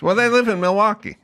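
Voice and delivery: high comic host voice; Jay Leno. Retold or imitated. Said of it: "He goes".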